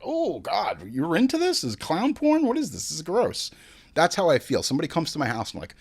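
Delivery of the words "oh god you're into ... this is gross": high, mock-disgusted friend voice; mock disgusted guest asking about clown porn. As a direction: act out a grossed-out guest, pitched way up. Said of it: "And they're like".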